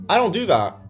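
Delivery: high, loud indignant protest; heated denial. Real, not acted. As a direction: burst out denying it. Angry denial, then repeated.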